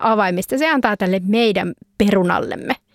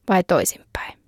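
breathy conspiratorial whisper, a bit quicker; whispered aside; 3 semitones lower, 3 dB quieter than usual. Real, not acted. Host wondering who really won, whispered aside. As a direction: lean in and whisper the doubt. Sly aside about the score.

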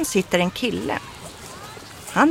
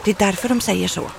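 plain reading voice

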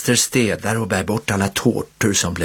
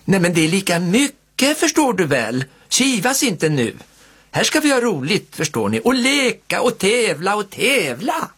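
lightly voiced kind aunt, higher, calming quarrels; soothing aunt; 10 semitones higher than usual. A narrator's reading. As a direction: coax the kids back to fun. Aunt soothes squabbling kids.

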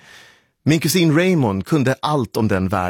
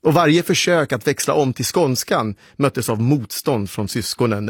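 ordinary delivery

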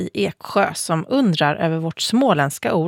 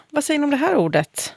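ordinary delivery

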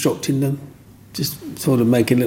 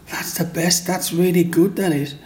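put-on praising kids; 6 semitones higher than usual. Kids praising his carving.

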